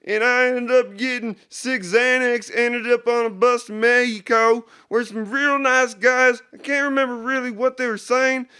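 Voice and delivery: high loud rambling yokel voice; hillbilly testimonial voice. Acted. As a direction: gush like a dopey customer. Fake testimonial character.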